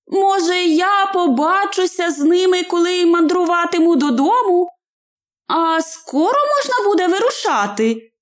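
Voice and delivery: high, wistful drowsy voice; sleepy hobbit. A narrator's reading. Hobbit asking wizard when they can leave, high sleepy voice. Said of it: "мовив Більбо сонливим голосом".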